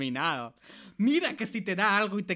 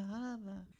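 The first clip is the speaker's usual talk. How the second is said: echo; 12 dB quieter than usual. Acted. Echo of previous word.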